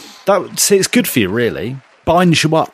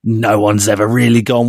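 ordinary talk, nothing marked

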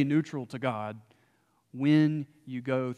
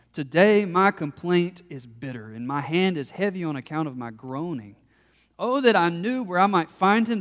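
raised, impassioned reading of Job's lament; fervent Bible reading, often louder; scripture reading; 2 semitones higher, 4 dB louder than usual. Acted. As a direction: read it like a man pleading his case. Reads Job's complaint aloud.

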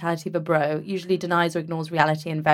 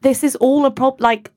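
loud, higher indignant rant; 7 semitones higher, 7 dB louder than usual. Real, not acted. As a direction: fire back in disbelief. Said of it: "indignant rant".

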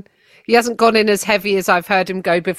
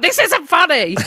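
mocking critic; 9 semitones higher than usual. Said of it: "people are like".

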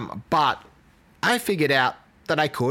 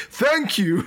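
booming Santa thank-you, pitched up and a bit louder; big Santa voice; 7 semitones higher, 3 dB louder than usual. Retold or imitated. Still stuck in Santa voice, thanking his boss with a booming jolly thank-you. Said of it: "in a big Xander voice as well".

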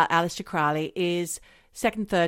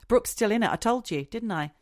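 normal talking voice

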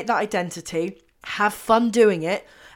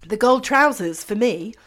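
normal talking voice

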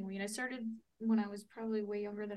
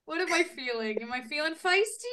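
louder, laughing, hammed-up inner monologue; mock dramatic; 6 semitones higher, 10 dB louder than usual. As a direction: play a hammed-up crossroads moment, voice climbing. Mock inner voice choosing a mood.

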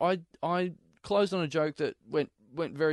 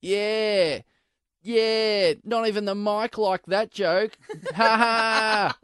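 mocking heckler; 5 semitones higher, much slower than usual. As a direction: play the smug heckler laughing at his own joke. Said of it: "this guy up the back goes".

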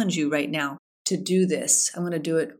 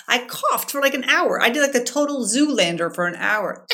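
laughing; 6 semitones higher, 4 dB louder than usual. Real, not acted. Laughing about her coughing fit.

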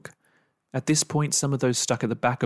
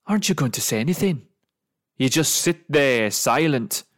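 angry taunt; 3 semitones higher, 5 dB louder than usual. Acted. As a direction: goad her angrily. Angry man taunting silent woman.

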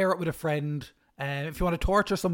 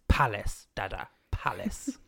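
put-on toddler correcting; 6 semitones lower, slower than usual. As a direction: whisper "palace" like a toddler correcting dad. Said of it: "it was palace".

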